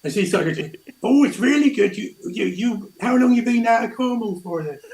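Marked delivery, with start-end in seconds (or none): 1.0-4.8 high, lively imitation of a Cornish accent; strong Cornish accent. Cornish woman at the theatre asking a coworker, imitated Cornish accent. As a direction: put on a broad Cornish accent. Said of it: "the strongest Cornish accent".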